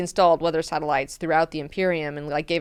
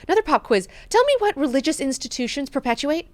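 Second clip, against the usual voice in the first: higher, mock-imperious quizzing voice; mock Leto quiz; 7 semitones higher, faster than usual. God Emperor demanding an answer from Mineo, mock-imperious. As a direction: play the god-emperor testing him.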